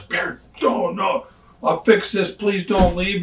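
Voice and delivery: high, pleading plea not to quit; pleading boss. Retold or imitated.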